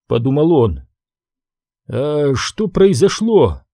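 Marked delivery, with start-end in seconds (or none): none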